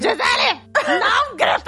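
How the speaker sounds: high-pitched hammed-up yell; mock shouting. Parent yelling at child not to yell, mock shout. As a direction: shout it while on the verge of laughing.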